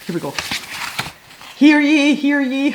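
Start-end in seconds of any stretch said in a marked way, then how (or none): 1.6-2.7 high, loud play proclamation; mock town crier. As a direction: proclaim it like a pretend herald. Mock proclamation from a regal scroll.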